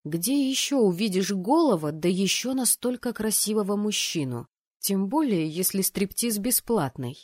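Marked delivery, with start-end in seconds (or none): none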